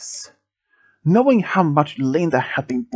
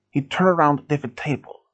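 ordinary delivery, nothing marked

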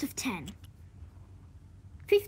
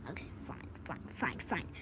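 whispered grudging; 10 semitones lower, 16 dB quieter, faster than usual. Acted.

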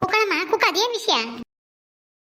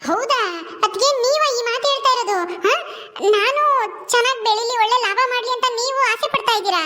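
higher-pitched, mildly incredulous questioning; pleasantly surprised; 5 semitones higher than usual. Acted. Younger woman hearing sister-in-law wish her success, pleasantly surprised. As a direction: ask in raised-pitch disbelief. Sister-in-law's unexpected good wishes.

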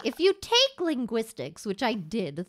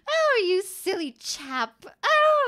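drawn-out British teasing; mock British; 6 semitones higher, 4 dB louder, slower than usual. Streamer acting out "oh, you" in context, mock British. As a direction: coo it like a silly Brit. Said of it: "it depends on the context".

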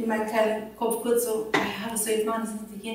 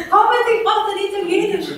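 higher, louder call to Peter, laughing; excited call; 9 semitones higher, 6 dB louder than usual. Retold or imitated. She calls her husband Peter over to translate, laughing. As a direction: wave him over eagerly.

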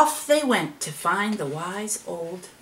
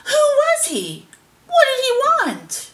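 very high, wailed questions; dismayed cry; 4 dB louder than usual. A narrator's reading. Animals wondering about the vanished visitor, high cry. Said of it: "the animals cried".

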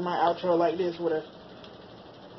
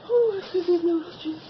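high pained burning gasp; 10 semitones higher than usual. Real, not acted. Reacting to burning noodles.

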